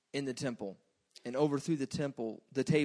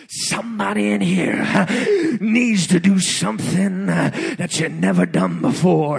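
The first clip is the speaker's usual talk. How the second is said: louder, slow, stretched-out preaching; preacher's delivery; 4 semitones higher, 14 dB louder, slower than usual. Retold or imitated. Preacher showing how the preacher really said it, loud and slow. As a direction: boom it out like a preacher at full force. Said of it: "He said it more like this".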